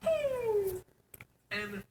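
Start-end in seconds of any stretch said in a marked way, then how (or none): 0.1-0.8 high hooting owl; owl call. Retold or imitated. The owl calls back, high hoot.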